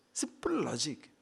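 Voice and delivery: hushed, near-whispered aside; quiet punchline. Real, not acted. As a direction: drop it to a soft whisper. Quiet punchline after laughing.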